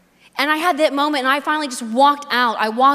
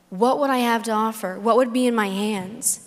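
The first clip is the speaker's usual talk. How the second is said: normal talking voice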